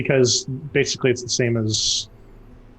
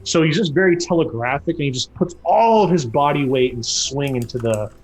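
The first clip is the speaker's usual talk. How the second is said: ordinary talk, nothing marked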